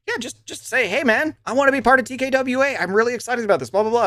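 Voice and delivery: high-pitched, quick coaching of a friend; mock eager pitch. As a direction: act out an eager pitch to join.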